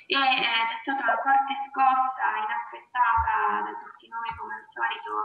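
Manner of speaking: ordinary talk, nothing marked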